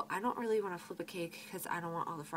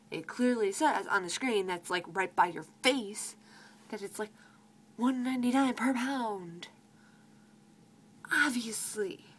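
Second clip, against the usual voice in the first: exasperated; 2 semitones higher, 6 dB louder than usual. Retold or imitated. Exasperated answer about obvious price.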